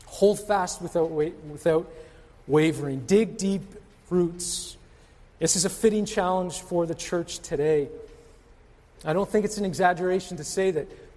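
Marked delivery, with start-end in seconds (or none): none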